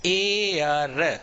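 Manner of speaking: एयर very slowly, stretched sound by sound, no h; drawn-out pronunciation. Shown as a demonstration. Teacher models the word for students, एयर drawn out slowly. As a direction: stretch out एयर from a long opening vowel. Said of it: "ये एयर होता है".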